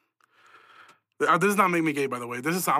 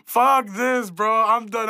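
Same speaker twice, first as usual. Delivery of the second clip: exasperated; 6 semitones higher, 6 dB louder, much slower than usual. Real, not acted. Frustrated outburst after laughing.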